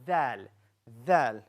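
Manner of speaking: dal said clearly as a letter sound; letter sound demo. Shown as a demonstration. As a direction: model dal for the class. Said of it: "And then we have".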